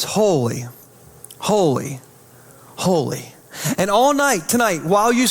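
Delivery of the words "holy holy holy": low, hushed awe with long falling words; reverent refrain. Acted. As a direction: intone each word slowly, letting it settle. What echoes tomorrow morning.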